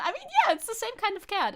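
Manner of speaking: very high, loud burst of agreement; excited agreement. Real, not acted. Streamer agrees with chat's cat comparison, thrilled high burst. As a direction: jump in thrilled.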